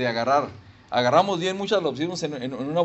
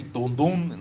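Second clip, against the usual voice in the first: deeper trumpet imitation. Shown as a demonstration. Preacher correcting his trumpet imitation, low booming "tum". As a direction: imitate a big horn, not a squeaky fanfare. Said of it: "pero no tu, tu".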